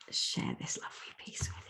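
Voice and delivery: hushed, breathy whisper; soft secret whisper. Real, not acted. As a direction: lean in and whisper it. Whispered aside before sharing.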